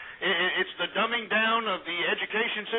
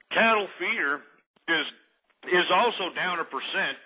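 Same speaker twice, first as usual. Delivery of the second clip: usual speaking voice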